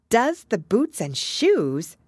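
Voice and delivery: slow, high, puzzled echo; wondering. A narrator's reading. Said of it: "repeated in a wondering tone".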